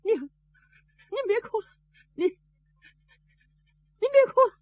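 halting, high-pitched plea; holding back tears. A narrator's reading. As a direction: choke back a cry while consoling. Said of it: "不好意思大声跟着哭". (忍着哭断续地劝)

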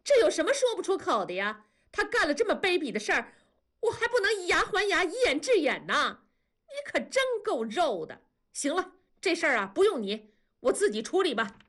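high-pitched, fast indignant tirade. A narrator's reading. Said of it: "文丽的声音高了八度说". (声音高八度，又急又气)

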